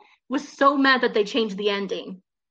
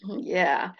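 usual speaking voice

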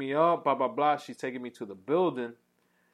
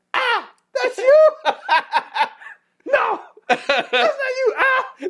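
high, loud, slow laughing put-on voice; squeaky hammed-up disguised voice; 9 dB louder, slower than usual. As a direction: fake a voice so you won't be recognized. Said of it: "You trying to change your voice?".

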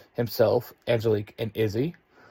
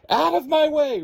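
very high, loud call while rushing; urgent dash; 5 dB louder than usual. A narrator's reading. Diving out of the car, a very high, loud cry. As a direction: fling it out as she runs past. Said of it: "Ruthie says, diving out of the car".

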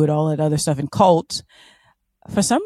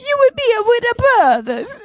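very high, silly mock-Italian accent; Jared Leto; faster than usual. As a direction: squeal it out as a parody Italian accent. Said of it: "It is Jared Leto".

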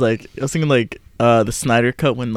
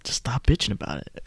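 whispered, much softer dismissive doctor; doctor's voice in your head; 2 semitones lower, 10 dB quieter than usual. Retold or imitated. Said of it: "your doctor's voice came into your head".

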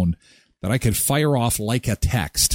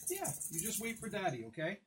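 much softer, hushed reassurance; soft to dog; 6 semitones higher, 22 dB quieter than usual. Real, not acted. Owner quietly reassuring his dog to wait. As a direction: hush it to the dog.

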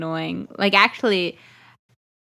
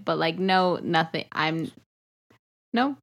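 normal talking voice

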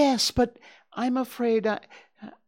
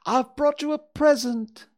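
eager, louder, bouncing; excited Pooh voice; 4 semitones higher, 6 dB louder than usual. A narrator's reading. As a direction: burst out with the news. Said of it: "said Pooh excitedly".

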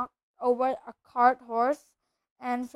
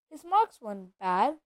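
plain reading voice

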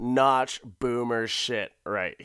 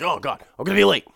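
put-on fast, loud panicked old man; hurried hammed-up alarm; mock panic; 6 semitones higher, 6 dB louder, much faster than usual. Husband panics waking up.

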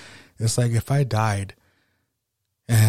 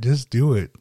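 ordinary talk, nothing marked

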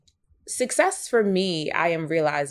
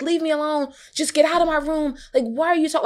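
higher-pitched mock upset child; retold child meltdown; 11 semitones higher, 4 dB louder than usual. Ten-year-old son pushing his mother away mid-meltdown, retold upset. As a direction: imitate an upset child lashing out, lightly. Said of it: "was having a complete emotional meltdown".

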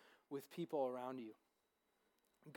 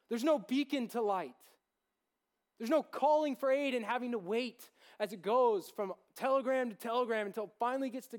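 much higher and louder, a soaring sermon peak; preaching at full force; 7 semitones higher, 9 dB louder than usual. Acted. Pastor rousing the young group about calling God, full-force preaching. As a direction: drive it home to the room.

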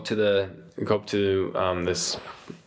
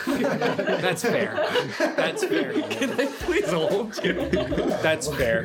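high voice with laughter in it; sheepish apothecary; 11 semitones higher, slower than usual. Acted. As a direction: back off politely in a high character voice. Apothecary refused, replayed amid laughter.

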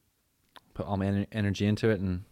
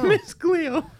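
psychic hotline voice; 9 dB louder than usual. Retold or imitated. Imitating TV psychic.

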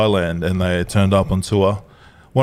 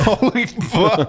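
high, slow, drawn-out disbelief with laughter; mock shock; 11 semitones higher, much slower than usual. Retelling finding the mate on the road, high stunned exclamation. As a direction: stretch out the astonished curse.

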